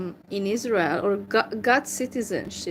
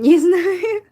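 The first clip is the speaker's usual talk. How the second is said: laughing; 6 semitones higher, 7 dB louder than usual. Real, not acted. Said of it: "laughing at own struggle".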